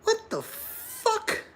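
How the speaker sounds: drawn out like a baffled friend; squeaky, slow disbelief; imitating Pete. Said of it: "Pete was probably going like".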